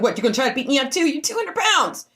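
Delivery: angry retold retort; fast angry challenge. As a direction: fire it back at her quick. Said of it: "I said".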